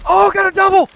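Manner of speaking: high, loud victory yell; excited shout. Real, not acted. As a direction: shout with delight at a double kill. Double kill in game.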